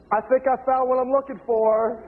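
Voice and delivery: high-pitched, mock-innocent announcement; acted coy announcement. Researcher telling the librarian of his find, mock-innocent. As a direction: pitch it way up, playing the understatement for laughs.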